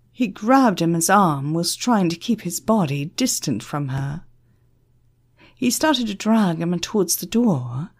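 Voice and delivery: ordinary delivery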